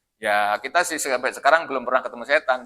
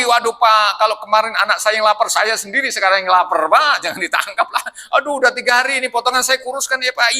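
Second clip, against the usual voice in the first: 1 pleading thief; 6 semitones higher than usual. Acted. Thief begs the guard.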